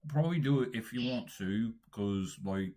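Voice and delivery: slow, drawn-out put-on British; British accent. Shown as a demonstration. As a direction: explain in a leisurely British accent. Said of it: "only talking in a British accent".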